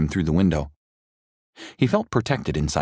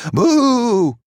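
very high, loud witchy shriek; witch boo; 8 dB louder than usual. A narrator's reading. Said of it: "she yelled".

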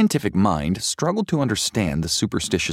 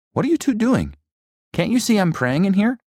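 put-on high boyish voice, put out at being interrupted; annoyed boy; 3 dB louder than usual. A narrator's reading. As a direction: scold the intruders in a higher boy voice.

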